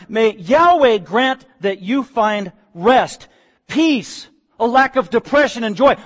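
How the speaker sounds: drawn-out prayerful blessing; fervent blessing. Acted. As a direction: bless them slowly and fervently. Naomi's blessing, verse nine.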